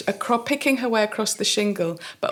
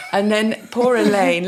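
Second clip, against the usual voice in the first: ordinary talk, nothing marked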